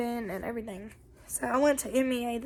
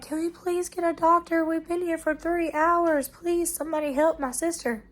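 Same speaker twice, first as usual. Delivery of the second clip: higher-pitched, urgent plea for help; retold plea, pitched up throughout; pleading sister; 6 semitones higher than usual. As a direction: imitate a desperate relative begging staff. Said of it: "And the sister was like".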